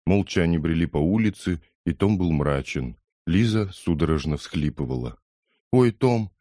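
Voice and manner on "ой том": mildly moaning, higher voice; a small plaintive outcry; moaned. A narrator's reading. Лиза to Том after the fight, lightly moaned. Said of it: "наконец простонала она".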